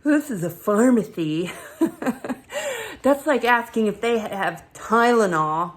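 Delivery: loud, scoffing know-it-all doctor; mock the doctor's condescension; snarky doctor brushing off her worry; cocky snark. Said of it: "he was so cocky and snarky".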